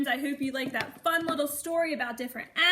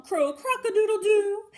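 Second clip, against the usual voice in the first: a loud, higher cock-a-doodle-doo; rooster crow; 8 semitones higher, 10 dB louder than usual. Acted. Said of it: "flustered the fowl, who crow".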